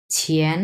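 ছিয়েন with an aspirated chh and rising tone; aspirated chh sound. Shown as a demonstration. Teacher shows ছিয়েন to learners, aspirated and rising. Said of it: "অনেকে চ বলে".

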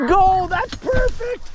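loud high yell of delight; shouting over wind. Real, not acted. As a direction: shout go, then cheer the shot. Calling shot, birds in.

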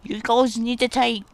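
shouting orders at the squad; yelling at the troops to take cover; mock shout. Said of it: "He's like".

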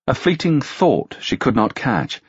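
usual speaking voice

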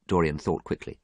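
plain reading voice

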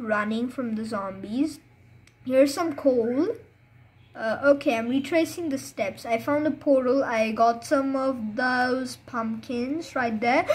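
ordinary delivery

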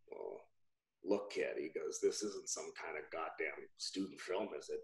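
low, hushed imitation of the client; gruff-client retelling. Client doubting his agent over a student film, retold low and quiet. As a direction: imitate him low and under-projected. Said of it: "And he said".

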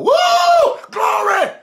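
high, loud congregational whoop; hollering. Acted. Congregation member reacting to a testimony, high excited holler. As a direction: burst out with mock church fervor. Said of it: "ain't nobody hollering".

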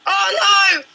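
loud oh no yelp; loud dismay. Real, not acted. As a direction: cry out as you fall. Falls off in the game.

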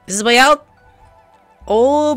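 ordinary talk, nothing marked

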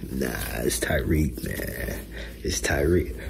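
whispered aside; breathy whisper. Real, not acted.

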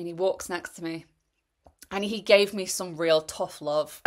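ordinary talk

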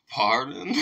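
a startled "Pardon?" that jumps up and cracks; imitating the singer's voice crack on "Pardon?"; mock voice crack. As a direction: crack your voice on "Pardon?" like he got caught off guard. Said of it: "With that voice crack".

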